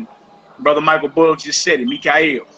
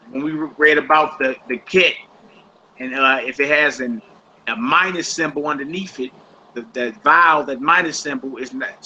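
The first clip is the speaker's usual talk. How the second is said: normal talking voice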